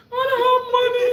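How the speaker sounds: high, loud laughing wail; hammed-up sob, high and loud; mock crying. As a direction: wail it like a broke kid. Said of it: "I said, I cried".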